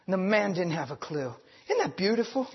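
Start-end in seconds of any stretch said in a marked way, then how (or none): none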